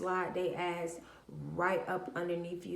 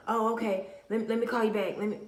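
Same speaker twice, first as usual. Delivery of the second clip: brush-off; 4 semitones higher, 5 dB louder, much faster than usual. Retold or imitated. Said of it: "these people will be like".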